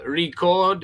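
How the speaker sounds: "Record" as a verb, re-CORD, stress on the second syllable; short ri- then CORD. Shown as a demonstration. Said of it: "One, it's a verb".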